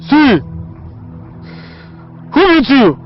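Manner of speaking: loud, high-pitched scolding; angry taunt. Acted. Attacker scolds a pleading victim, loud and angry. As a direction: round on the victim sharply.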